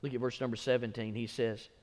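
usual speaking voice

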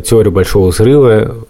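normal talking voice

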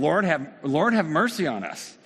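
yelling out for mercy. Retold or imitated. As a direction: call out loud like a desperate beggar. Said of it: "they yell out to him".

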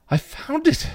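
very high, crazed voice; strained madman's exclamation; insane knight. A narrator's reading. Insane knight on finding the key, crazed. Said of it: "the insane knight said".

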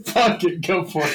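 ordinary delivery

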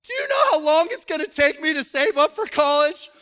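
put-on high, loud wailing complaint of a little boy; crying child. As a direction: wail it through tears. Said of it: "he comes back out and he's crying".

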